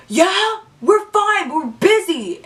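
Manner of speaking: put-on voice, shooing someone away; annoyed guy's brush-off. Drake to friend through the door, annoyed brush-off.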